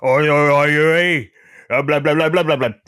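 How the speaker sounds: hammed-up Cockney impression babbling away; Cockney accent. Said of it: "I think your favourite one was".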